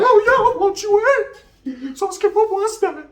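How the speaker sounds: very high, loud, slow put-on voice; hammed-up comic voice. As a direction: ham up a smug pickup line. Flirty retold reply to sister.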